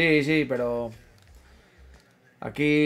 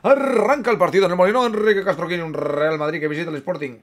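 match commentator kick-off; 3 semitones higher, 4 dB louder than usual. Acted. Streamer opens the match for his companions, commentator's announcing voice. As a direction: belt out the kick-off like a radio commentator.

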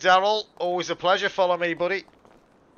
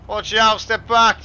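loud, raised-pitch caution; urgent warning; 4 semitones higher, 6 dB louder, slower than usual. Pretend. Dealer clears people from a car, calling out. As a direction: call out to clear the way.

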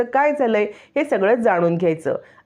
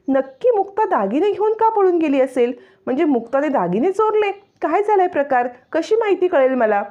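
much higher, wondering voice; suspicious inner thoughts; 9 semitones higher than usual. Acted. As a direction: puzzle it out to herself. Savani wonders to herself. (उंच आवाजात संशयाने स्वतःशी विचार)

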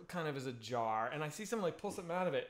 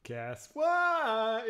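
higher mock goat voice, "what" stretched out; boasting goat; 5 semitones higher than usual. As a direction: play a goat bragging to the herd. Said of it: "walking back to the other goats being like".

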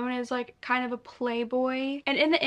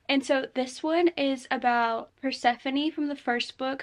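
ordinary talk, nothing marked

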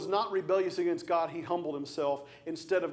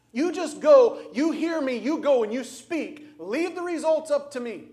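God pressing Ezekiel, louder and higher; urgent divine command; 7 semitones higher, 7 dB louder than usual. Acted. God commanding Ezekiel to go speak, louder and higher. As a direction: charge him like God sending a prophet.

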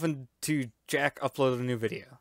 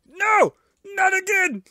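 loud high cry of despair; high loud dismay; 1 dismayed shout; 5 dB louder, slower than usual. Real, not acted. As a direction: yell it as you fail again. Dying again in game.

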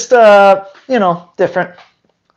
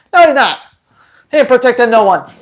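ordinary delivery

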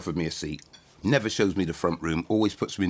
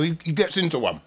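ordinary talk

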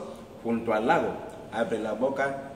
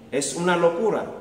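koala exclaiming; 6 semitones higher, 5 dB louder than usual. Acted. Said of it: "exclamó el koala".

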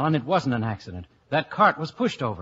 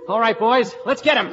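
excited call; 7 semitones higher, 6 dB louder than usual. Acted. Rallying the men to chase.

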